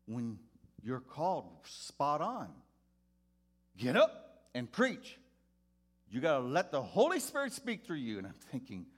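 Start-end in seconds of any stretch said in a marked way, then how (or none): 3.8-8.2 preacher urging. Retold or imitated. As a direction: drive it home like a revival call. Pastor urging boy to preach.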